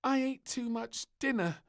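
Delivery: put-on a girl with a too-full tummy; whiny little groan; groaning princess. A narrator's reading. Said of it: "she groaned".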